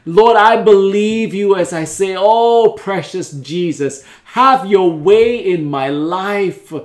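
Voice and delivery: loud, swelling prayer to God; fervent prayer. Acted. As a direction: cry out in faith with stretched key words.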